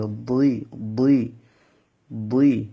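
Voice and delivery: বুই said thick and full in the mouth; heavy full-mouthed sound. Shown as a demonstration. Teacher models a heavy বুই for students. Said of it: "আওয়াজটা মুখের মধ্যে মোটা হয়ে উচ্চারণ হবে".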